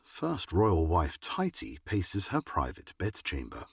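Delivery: ordinary talk, nothing marked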